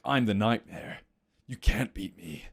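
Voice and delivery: low, slow menacing boast; mock the nightmare villain. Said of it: "normally he's like".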